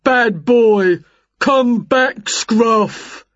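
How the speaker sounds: loud put-on character voice; scolding giant neighbour. A narrator's reading. Jerry calling back the dog chasing Winnie, scolding. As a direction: call the dog back firmly.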